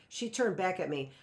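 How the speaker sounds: plain reading voice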